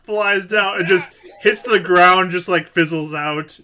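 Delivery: loud, high, slow dramatic narration; game master narration. Acted. Game master describes a fizzled orb, loud dramatic narration. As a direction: draw out the failed spell, loudly.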